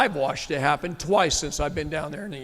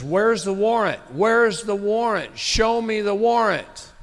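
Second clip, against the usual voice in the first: demanding; 6 semitones higher, 4 dB louder than usual. Retold or imitated. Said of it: "she says".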